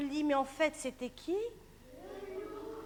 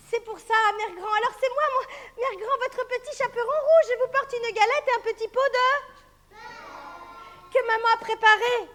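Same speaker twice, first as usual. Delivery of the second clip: put-on higher sweet girl; little girl voice; 7 semitones higher, 5 dB louder than usual. Girl greeting grandmother, higher. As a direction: chirp it like a child. Girl's voice at the door.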